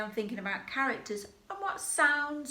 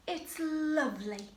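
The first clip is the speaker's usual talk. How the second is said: higher, dreamy sigh of a voice; Cinderella's delight; 6 semitones higher than usual. Shown as a demonstration. Teacher voices Cinderella at the ball, higher and dreamy. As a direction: marvel like a princess at the ball. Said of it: "you say".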